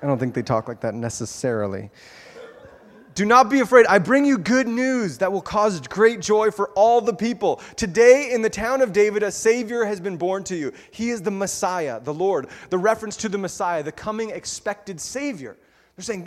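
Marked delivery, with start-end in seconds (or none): 3.1-12.5 proclaiming angel. Acted. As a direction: announce it like a herald from heaven.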